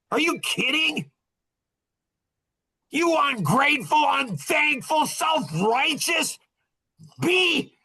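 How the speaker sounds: a husband reliving his outrage at the woman, high shouted name-calling; retold angry rant. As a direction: spit out each insult high and furious, dragging them out. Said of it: "man, I was pissed".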